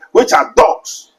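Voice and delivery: which are dogs drawn out very slowly and high; scornful slow emphasis; slow emphatic punch. Acted. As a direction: land the insult slowly.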